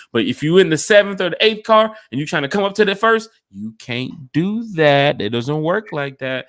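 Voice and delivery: half-joking scold; mock scolding. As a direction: lay down the rule to rule breakers. Scolding train car jumpers.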